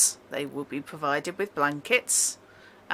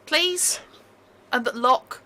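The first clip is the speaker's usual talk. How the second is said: put-on pleading accented prisoner; 5 semitones higher, 4 dB louder than usual. Prisoners begging for secure rooms.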